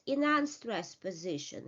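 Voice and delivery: usual speaking voice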